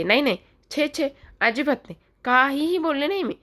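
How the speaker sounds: high-pitched, protesting innocence; emphatic denial. Acted. Hasty denial when asked if she spoke. (ठाम नकार, उंच सुरात)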